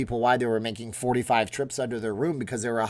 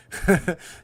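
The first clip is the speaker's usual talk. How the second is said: burst of laughter; 7 semitones higher, 4 dB louder than usual. Real, not acted. Laughs after impression.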